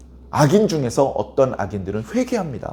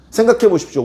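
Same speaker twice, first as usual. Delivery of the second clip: ordinary delivery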